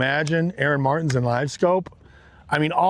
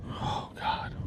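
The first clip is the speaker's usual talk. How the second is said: hushed, breathy whisper of disbelief; whispered exclamation; 13 dB quieter than usual. Real, not acted. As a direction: whisper it under your breath. Fishing alone, reacting under his breath.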